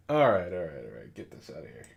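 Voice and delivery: ordinary talk, nothing marked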